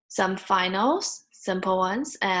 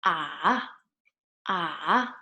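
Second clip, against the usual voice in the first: "ah" dipping low, then rising; third tone; 3 semitones lower than usual. Shown as a demonstration. Teacher explains third tone, "ah" down and up. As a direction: scoop "ah" down and back up. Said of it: "third tone goes down and up".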